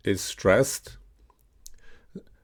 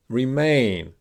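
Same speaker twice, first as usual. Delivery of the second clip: Remain with MAIN long and falling; short Re, punch out MAIN in Remain; stress on second syllable. Shown as a demonstration. Teacher giving words to repeat, second syllable of Remain punched. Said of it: "stressed on the second syllable".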